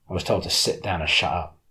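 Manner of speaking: plain reading voice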